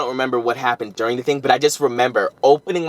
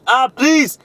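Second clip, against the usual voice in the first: exasperated; 11 semitones higher, 4 dB louder than usual. Retold or imitated. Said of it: "I was like".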